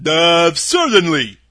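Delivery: loud, slow scarecrow voice; husky. A narrator's reading. Scarecrow confirming to Dorothy that he speaks, husky. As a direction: answer big and husky, drawn out. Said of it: "in a rather husky voice".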